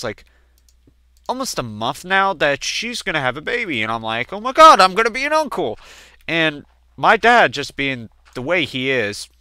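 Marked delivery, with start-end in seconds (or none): 4.3-5.8 excited outburst. Retold or imitated. Hearing sister is having a baby.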